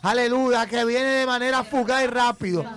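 fervent, insistent prophetic proclamation; prophetic preaching. Real, not acted. Pastor promising Joana a testimony, fervent preaching. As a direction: drive each promise home with conviction.

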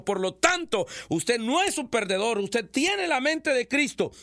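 fiery preaching. Acted. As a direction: proclaim it like a revival preacher. Preaching at full force.

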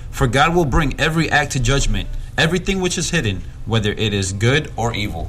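firm, emphatic Bible reading; scripture reading. Acted. Preacher reads a closing verse to the congregation, scripture reading. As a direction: read the verse out with weight.

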